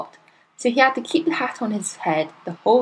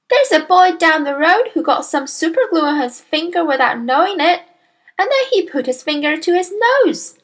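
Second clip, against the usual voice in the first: put-on high, childlike voice; little girl storyteller, artful; 8 semitones higher, 4 dB louder than usual. A narrator's reading. Daughter telling father about a glued boy, little girl voice. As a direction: pipe up with a cautionary tale. Matilda tells tale.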